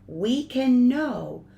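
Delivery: storybook reading with swelling and hushed lines; gentle expressive story voice for the kids; reading to children. Acted. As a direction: read it like a bedtime picture book. Reads picture book to congregation.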